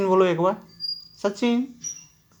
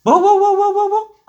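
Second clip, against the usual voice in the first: barking; 11 semitones higher, 6 dB louder than usual. Shown as a demonstration. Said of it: "एक बार बोलो।".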